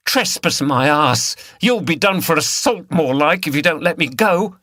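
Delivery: defiant woman, loud and high; angry. A narrator's reading. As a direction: spit back the retort. Liz fights back.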